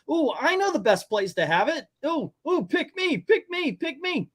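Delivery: very high, excited mock-pupil voice; eager volunteer. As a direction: beg to be picked like an overkeen kid. Acts eager pupil volunteering an idea.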